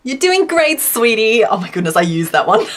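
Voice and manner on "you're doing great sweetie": perky sweet-talk. Retold or imitated. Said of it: "the more, like, perk you can put into the words".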